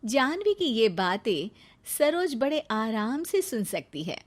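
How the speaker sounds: ordinary delivery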